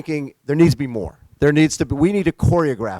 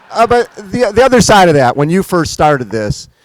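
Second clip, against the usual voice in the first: usual speaking voice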